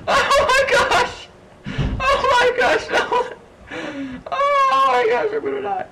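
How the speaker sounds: shrieking very high, half laughing and half crying; real panic laughter, squealing way up high; slow high squeal of laughing terror. Real, not acted. Overrun by monsters, panicking.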